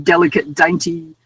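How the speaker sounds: ordinary talk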